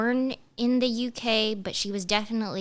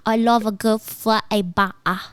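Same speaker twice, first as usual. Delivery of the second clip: mock British accent; 7 dB louder than usual. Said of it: "British sound button".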